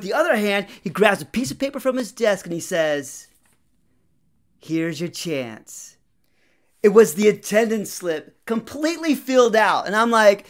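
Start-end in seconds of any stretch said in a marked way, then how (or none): 4.6-6.0 slow hint. Retold or imitated. Teacher handing over slip.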